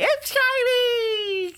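high, slow, crying squeal; mock dazzled squeal. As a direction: wail it like a dazzled child. Mocking glitter fans.

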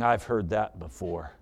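low, soft, doubting wife; quiet scepticism. Acted. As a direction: say it softly and low, unconvinced. Wife doubting husband's promise.

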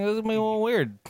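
high, light mock-nervous aside; mock nervous thought. Thinking before family dinner, high mock-nervous aside.

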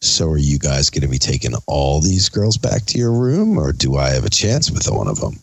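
creepy guy. Retold or imitated. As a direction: imitate the sleazy stranger, low and slimy. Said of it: "And he's like".

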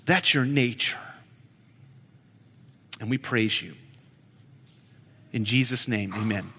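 low, soft, unhurried prayer to the Lord. Real, not acted. Pastor praying softly to God before the sermon, reverent. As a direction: pray quietly and reverently.

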